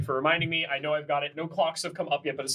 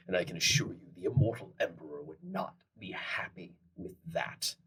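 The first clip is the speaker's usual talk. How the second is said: whispered threat; 10 semitones lower, 7 dB quieter than usual. Acted. Hushed threat in NPC voice.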